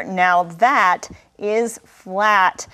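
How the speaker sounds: slow, with "that" punched hard; stress on THAT. Shown as a demonstration. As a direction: lean on "that" and drag the line out. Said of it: "you really have to emphasize the word that".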